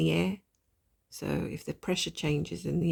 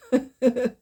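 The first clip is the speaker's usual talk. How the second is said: amused laugh; 7 semitones higher, 8 dB louder than usual. Real, not acted. Laughs at own mistake.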